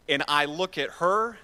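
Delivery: ordinary talk, nothing marked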